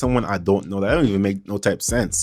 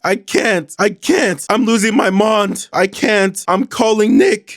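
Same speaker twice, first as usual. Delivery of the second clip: high and rising panic, slowing down; frantic texter voice; 10 semitones higher, 3 dB louder, slower than usual. Retold or imitated. Reading Heather's panicked texts to Louis, high and frantic. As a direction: voice her spiraling out, desperate. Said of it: "Heather comes back saying".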